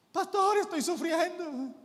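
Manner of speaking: high, whiny mock crying; imitating a weepy woman phoning her pastor; tearful caller.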